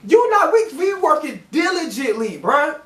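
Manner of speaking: high-pitched indignant protest. Retold or imitated. Worker protesting to a critical supervisor, indignant. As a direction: pitch jumps up in outraged disbelief.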